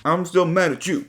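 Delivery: lightly voiced sulky boyfriend; a hint of a pout, sulking; pouty boyfriend. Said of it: "He's still a little pouty".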